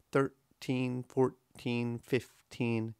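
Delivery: "Thirteen, fourteen, fifteen" as even, separate beats; level THIR-TEEN, FOUR-TEEN, FIF-TEEN; straight rhythm. Shown as a demonstration. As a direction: give both halves of "thirteen" equal weight, same for "fourteen", "fifteen". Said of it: "It's very straight".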